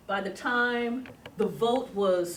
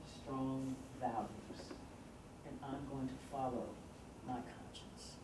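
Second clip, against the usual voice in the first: much softer, low and earnest; quiet conviction; 7 semitones lower, 14 dB quieter than usual. Retold or imitated. Tobias telling his handlers he follows conscience, hushed. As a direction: drop to a hushed, grave promise.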